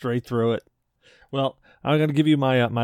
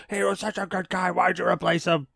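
high-pitched mocking imitation; mock complaining; 7 semitones higher than usual. Teasing a friend over his complaint, mock whining. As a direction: mimic a clueless griper, pitched up. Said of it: "being a total dick going".

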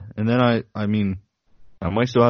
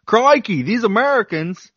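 louder, rising to a much higher, slow Australian exasperation; hammed-up Australian grumbling, climbing to a squeal; imagined Australian listeners mocking Americans, mock accent; 10 semitones higher, 6 dB louder, slower than usual. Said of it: "They might say".

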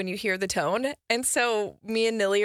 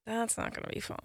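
whispered aside; 12 dB quieter than usual. Real, not acted. Aside about old age.

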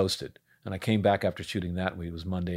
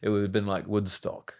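put-on London English accent. Actor redoing his audition line for the host, London accent. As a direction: play it as a London punk. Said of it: "That's such a good accent".

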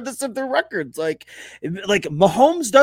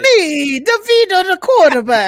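high, loud tough-guy Jersey voice; Tommy DeVito impression; 9 semitones higher, 6 dB louder than usual. Co-host shows the fumbling friend how, loud and high. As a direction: puff up and announce yourself as the hometown QB. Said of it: "give me a Tommy DeVito impression".

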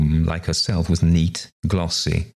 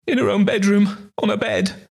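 much higher, wailing maid. A narrator's reading. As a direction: wail it out in distress. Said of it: "the other wailed".

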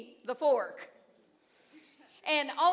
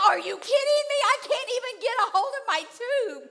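high, loud frantic "Are you kidding me?"; frantic disbelief; 8 semitones higher, 4 dB louder than usual. Retold or imitated. Struggling in the current, she thinks frantically, high-pitched. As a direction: sputter in panic, pitched high. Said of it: "I'm thinking".

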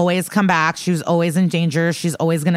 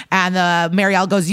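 usual speaking voice

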